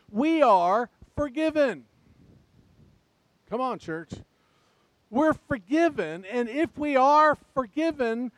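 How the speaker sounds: full-force pulpit preaching; fired-up preaching. Acted. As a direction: preach it out to the whole church.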